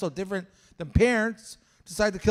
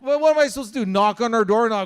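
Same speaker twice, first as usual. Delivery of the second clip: normal talking voice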